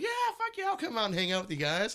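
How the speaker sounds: very high, loud mock enthusiasm; eager acceptance. Host retelling his yes to friends, high overeager voice. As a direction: squeal it like a keen, naive yes.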